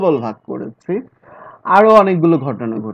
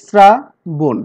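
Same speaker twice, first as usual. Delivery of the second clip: Shrabon broken in two, শ্রা then বন, with a clear pause; split into syllables; 5 dB louder, much slower than usual. Shown as a demonstration. Teacher dividing the word for students, Shrabon split as শ্রা, pause, বন. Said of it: "এখানে দুটি syllable".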